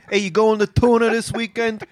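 high, thick Jersey guy voice; New Jersey accent. Retold or imitated. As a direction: play a Jersey guy heading off for the weekend. Joking New Jersey guys going to Mercury.

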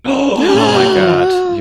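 shocked gasp. Real, not acted. Caught mispronouncing Thames.

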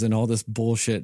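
usual speaking voice

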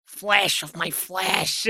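put-on slow, gruff, gravelly old mob boss voice; William Hickey impression. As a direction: drag each word out like a decaying old don. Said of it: "That's the best I can give you".

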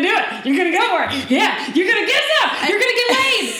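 high, loud, gleeful cheering; teasing hype. Retold or imitated. Joe teasing her about her man, rowdy excitement.

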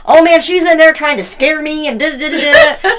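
high, loud mock griping; imitated agitated complaint. Imitating mom telling the guys, mock-agitated.

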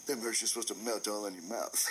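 low, deflated delivery; dropped pitch, subdued disappointment; acted resigned complaint. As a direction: imitate a beaten man grumbling to himself. Retold complaint after defeat, acted.

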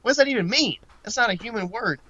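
high-pitched, baffled questioning; incredulous. Retold or imitated. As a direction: retell your disbelief, pitch jumping up. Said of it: "And I was like".

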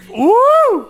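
high loud cheer; woo. Real, not acted. Cheering at the joke, loud whoop.

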